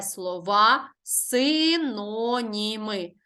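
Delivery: "слова синоніми" very slowly, syllable by syllable; slow dictation of "слова синоніми". Shown as a demonstration. Teacher spells out the answer.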